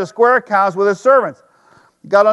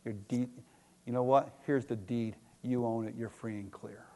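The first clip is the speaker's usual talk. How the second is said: much softer, lower forgiving words; a banker quietly freeing a debtor; gentle banker; 8 semitones lower, 14 dB quieter than usual. Retold or imitated. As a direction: hand over the deed gently. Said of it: "the bank president goes".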